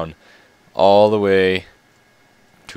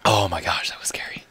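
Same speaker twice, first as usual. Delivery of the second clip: breathless whisper of relief; shaken whisper. Real, not acted. Player whispering to viewers after a close escape, shaken whisper. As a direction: whisper it, still scared.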